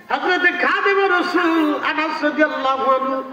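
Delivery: high melodic preacher's intonation; waz preaching. Real, not acted. Intones opening of a tradition. (উঁচু সুরে ওয়াজের বয়ান)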